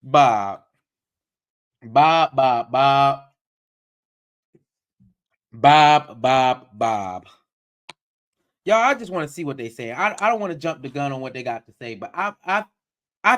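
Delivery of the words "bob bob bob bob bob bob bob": loud, low calls of Bob; exasperated calling. Real, not acted. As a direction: call him out in exasperation. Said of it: "exasperated calling out".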